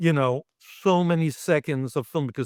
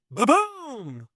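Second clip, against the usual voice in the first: higher, punchy ba-boom; mock explosion sound; 8 semitones higher than usual. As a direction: pop it out like a gunshot. Imitating the gunshot squib going off.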